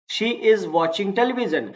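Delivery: slow model sentence. Shown as a demonstration. Teacher reads model sentence.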